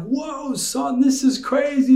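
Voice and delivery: high, playful mock amazement; amazed dad. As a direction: wow the kid with big surprise. Father reacts to son's tower.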